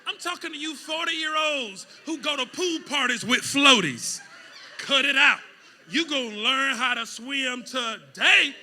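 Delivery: loud, high preaching; shouting sermon; full-force preaching. Acted. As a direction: preach it hard to the room.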